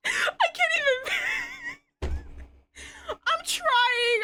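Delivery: high, squeaky laughter through the words; can barely talk for laughing; helpless giggling apology; crying with laughter. Real, not acted. Laughing fit while reading.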